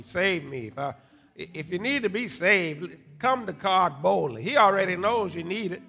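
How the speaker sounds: gentle pleading invitation; altar call. Acted. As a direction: invite them forward tenderly.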